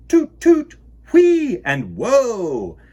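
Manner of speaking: very loud, high toot and whee; sound-effect words. Acted. Host showing children noisy sound words, loud and high. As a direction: belt the sounds like a toy train.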